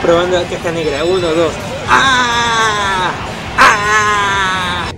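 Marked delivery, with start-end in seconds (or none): 1.9-4.9 grito agudo, largo y sostenido. Real, not acted. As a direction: alargar el grito a viva voz. (grito sostenido en plena partida)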